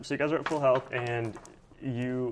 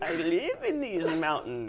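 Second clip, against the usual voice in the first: put-on old lady voice; 8 semitones higher, slower than usual. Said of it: "let me get an old lady voice going".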